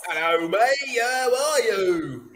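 slow, drawn-out mock Australian accent; hammed-up boozy Australian accent; drunk English Australian. Shown as a demonstration. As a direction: slur out an Australian greeting like a drunk English person. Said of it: "Like a drunk English person".

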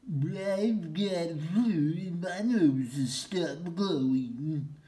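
slow, high, loud reindeer with flu, sorry for himself; sick reindeer voice. Acted. Rudolph explains his nose stopped glowing, slow and high. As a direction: drag it out like a poorly reindeer.

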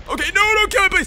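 high-pitched frantic pleading; panicked squeal. Real, not acted. Gamer under attack, panicked squeal. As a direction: squeal it out in a fluster.

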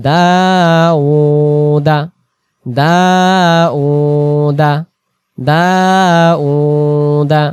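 দাউদা drawn out very slowly, vowels held long; slow recited pronunciation. Shown as a demonstration. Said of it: "উচ্চারণটা কি হবে?". (দাউদা ধীরে টেনে তিলাওয়াত)

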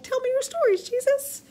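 a wide-eyed follower hanging on every word; high, eager fanboy asking Jesus for his fish stories; eager disciple. Pretend. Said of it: "old school disciple".

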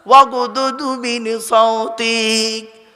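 slow, loud, drawn-out Arabic chant; loud recitation. Acted. Preacher reciting to the crowd, loud and drawn out. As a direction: call the verse out slowly and fully.

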